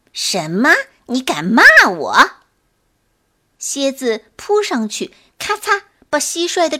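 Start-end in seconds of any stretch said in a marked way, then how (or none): 0.1-2.3 loud indignant outburst; outraged scorpion. A narrator's reading. Scorpion loudly outraged at the cricket's insult. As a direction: blow up at being insulted.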